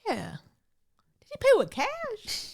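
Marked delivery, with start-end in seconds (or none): 1.2-2.5 nosy high voice. Pretend. Gossiping about Zuckerberg's visit.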